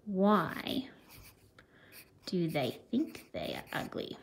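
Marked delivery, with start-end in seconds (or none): 2.3-4.2 hushed whisper; whispered question. Real, not acted. Teacher whispering a question to her pupils. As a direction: whisper it as if sharing a secret.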